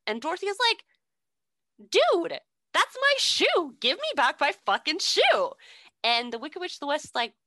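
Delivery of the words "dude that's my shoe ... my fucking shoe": whiny angry demand. Retold or imitated. Dorothy whining at the witch to return her shoe. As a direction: stretch it out like a furious kid.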